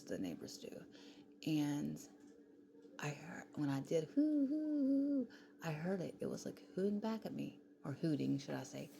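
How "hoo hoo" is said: high, loud retold owl call; owl hoots. Retelling how she hooted at the owl in her yard, loud owl hoots.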